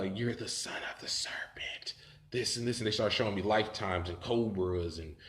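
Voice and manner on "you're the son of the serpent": slow, hushed serpent voice; whispering serpent. Retold or imitated. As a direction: whisper it slowly like an ominous revelation. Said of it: "these serpents were like".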